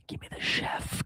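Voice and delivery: breathy whispered repeat, slow; whispering. Shown as a demonstration. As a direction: whisper it for emphasis. Repeats line, then whispers it.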